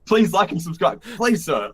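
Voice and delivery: high, comic pleading; playful imitation of a desperate plug; mock begging. Mimics guide begging for subscribers.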